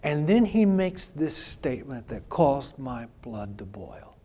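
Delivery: ordinary delivery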